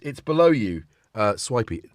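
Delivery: ordinary talk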